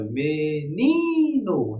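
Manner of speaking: "menino" with NI stretched out long; slow "me-NIII-no", teacher's demo; stress on penultimate syllable. Shown as a demonstration. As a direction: hold the middle syllable of "menino". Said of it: "é a penúltima".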